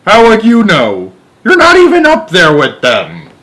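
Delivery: indignant. Acted. Astronaut argues with Houston.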